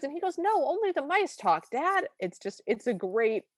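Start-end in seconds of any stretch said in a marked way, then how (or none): 0.4-2.1 high-pitched mock-exasperated son; exasperated correction. Prince correcting his father about talking animals, exasperated correction. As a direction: set Dad straight, pitched well up.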